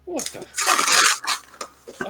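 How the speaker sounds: slow, breathless whispered disbelief; stunned whisper. Real, not acted. GM reacting to the party's chaos in a slow whisper. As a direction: let it fall out unvoiced in shock.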